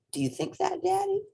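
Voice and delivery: put-on high childlike voice; little rabbit. A narrator's reading. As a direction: speak up brightly like a small child. Little Rabbit asks Daddy.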